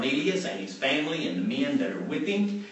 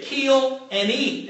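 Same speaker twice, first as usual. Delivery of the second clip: higher, very slow command; commanding voice from heaven; 8 semitones higher, 3 dB louder, much slower than usual. Retold or imitated. Heaven's voice commanding Peter again, high and slow. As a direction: stretch out each word like a divine order.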